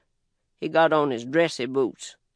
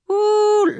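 high ooh; 6 dB louder than usual. Real, not acted. Exclamation before disgust.

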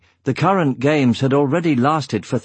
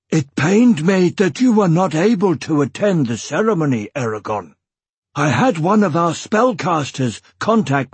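higher, emphatic dwarf voice; dwarf clan chief, regretful; 5 semitones higher than usual. Acted. Newly married dwarf explains the missed wedding invitation, regretful. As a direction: voice a heartfelt dwarf, pitched up.

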